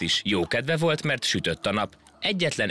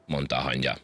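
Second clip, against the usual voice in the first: ordinary delivery, nothing marked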